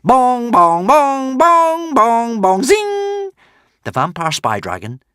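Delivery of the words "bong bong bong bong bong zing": bouncing darts. Acted.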